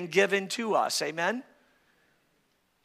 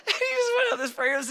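fast, higher talk through laughter; laughing while telling; much faster than usual. Real, not acted. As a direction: tell it still laughing. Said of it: "the cutest thing ever".

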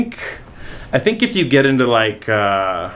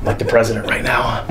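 whispering. Real, not acted. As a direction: whisper it to cheer them on. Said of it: "why I'm whispering".

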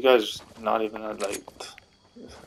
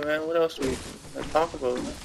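ordinary talk

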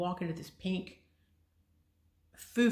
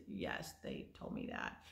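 tired, whispered, slower repeat; weary whisper; 10 dB quieter than usual. Retold or imitated. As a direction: sigh it out, worn down by hearing it again. Told bad news yet again.

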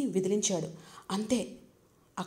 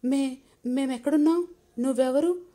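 scared and surprised; 5 semitones higher, 5 dB louder than usual. A narrator's reading. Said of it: "ఇద్దరూ కాస్త భయంతో, మరి కాస్త ఆశ్చర్యంగా".

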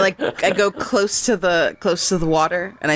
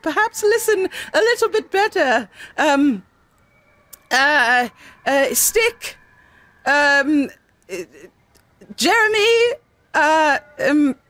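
rising strained pitch; 7 semitones higher, slower than usual. Real, not acted. Player struggling to invent names.

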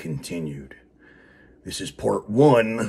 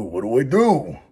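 higher, louder mock despair; put-on voice; 5 semitones higher, 5 dB louder than usual. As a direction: throw the question up in a put-on voice.

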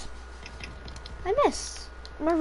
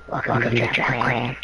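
deep put-on voice.

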